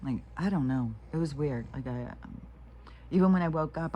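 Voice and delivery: normal talking voice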